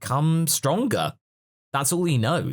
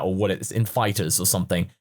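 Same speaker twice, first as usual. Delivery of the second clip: normal talking voice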